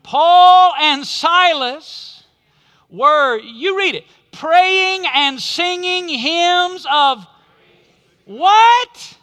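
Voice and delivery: slow, loud, high buildup ending in a call; dramatic preaching build. Acted.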